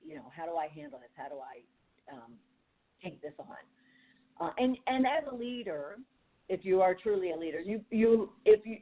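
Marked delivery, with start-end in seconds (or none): 0.0-3.5 much softer, hesitant asking-yourself voice; soft, half-murmured inner question on how to handle it; unsure self-questioning. Retold or imitated. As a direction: quietly puzzle it out to yourself. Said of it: "to say".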